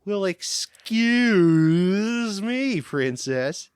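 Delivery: nasal, slow, drawn-out whine; nasally hero voice, high and stretched; Link voice. Retold or imitated. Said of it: "the Link voice is just me, but even more nasally".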